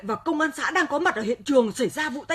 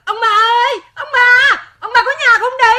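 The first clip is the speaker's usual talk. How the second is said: loud, higher, breathless call at the gate; urgent calling; 5 semitones higher, 7 dB louder than usual. Acted. Granddaughter at the gate calls the old couple, loud and urgent. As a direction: yell for them to come out now.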